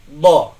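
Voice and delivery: teacher models the dod letter; Arabic dod. Shown as a demonstration. Teacher demonstrating dod to students, heavy and loud. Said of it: "kita tempelkan".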